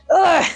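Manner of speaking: sharp high groan of sudden pain. Retold or imitated. Snapping Achilles, pained groan.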